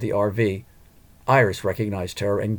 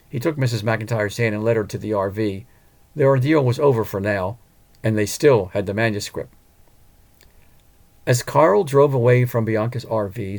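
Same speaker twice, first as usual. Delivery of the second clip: ordinary delivery, nothing marked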